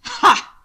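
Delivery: sharp, loud barked laugh; short barked laugh. A narrator's reading. Hannibal caught out by Will, a sharp barked laugh. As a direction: bark one short sharp ha. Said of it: "Hannibal barked a short, sharp laugh".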